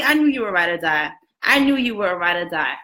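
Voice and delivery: ordinary delivery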